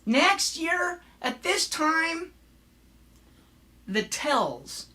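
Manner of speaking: "tells" said for tales, short lazy vowel; Sarah Palin. Retold or imitated. Showing how she pronounces it, mocking "tells". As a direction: draw out the phrase, flattening tales into "tells". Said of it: "listen to the way she pronounces".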